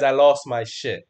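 ordinary talk

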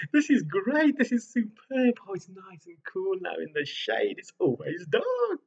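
high, giddy ape voice with a laugh; gleeful apes. Acted. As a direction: chatter with delight like an ape. Apes delighted in shade.

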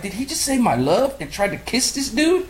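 high, outraged disbelief; high-pitched exasperated rant; incredulous rant. Retold or imitated. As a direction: squeal it like you can't believe it. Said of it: "I was like".

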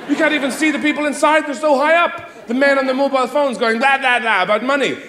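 ordinary talk, nothing marked

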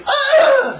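very high, loud mock scream; comic falling-man scream; Wilhelm scream. Game master voices an archer falling off a building, Wilhelm scream. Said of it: "Does a Wilhelm scream".

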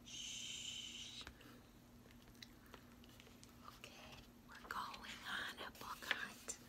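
hushed library whisper. Acted. Reader hushing listeners in the library, whispered. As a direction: whisper so the librarian won't hear.

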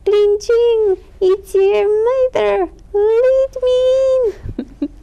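hammed-up sugary ogress voice; fake sweet voice. A narrator's reading. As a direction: overdo the sweetness like a disguised ogress. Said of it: "making her voice very sweet".

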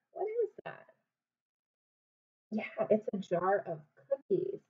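ordinary delivery, nothing marked

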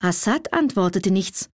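ordinary delivery, nothing marked

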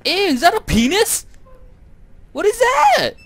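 high, loud squeal of disgust; grossed-out high yelp; disgusted shriek. Real, not acted. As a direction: recoil and shriek at it. Disgusted at a grotesque sight.

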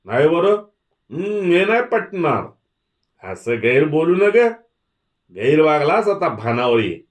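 high, loud voices of objection; clamouring protest. A narrator's reading. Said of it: "एकापाठी एक आवाज उठू लागले".

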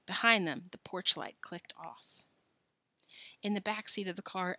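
ordinary delivery, nothing marked

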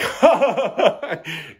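high, hammed-up burst of laughter; over-exaggerated laugh. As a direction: mimic a friend's overblown laugh. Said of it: "like, over-exaggerated laugh".

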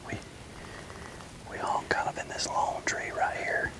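whispered throughout, staying silent in the tree stand; hushed whisper. Real, not acted. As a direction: keep it to a breathy hunter's whisper so the deer can't hear.

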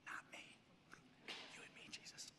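soft unvoiced whisper; conspiratorial whisper to Jesus; whispered aside. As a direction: lean in and whisper it. Peter acted as whispering.

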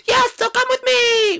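very high, loud, drawn-out squeal; urgent sidekick. Acted. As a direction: shriek the call to follow in a tiny voice. Sidekick calls him to follow.

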